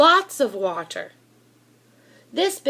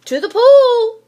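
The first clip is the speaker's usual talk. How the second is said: very high, loud yell; 11 semitones higher, 12 dB louder than usual. A narrator's reading. Boy yelling to the firemen about his fish, loud high yell. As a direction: shout it at the top of your voice. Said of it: "I yelled".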